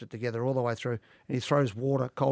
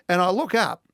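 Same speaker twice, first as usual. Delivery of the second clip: rising, louder, laughing through the story; excited laughing telling; 12 semitones higher, 9 dB louder than usual. Real, not acted. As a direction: laugh in disbelief as you tell it. Laughing disbelief seeing Hutchie.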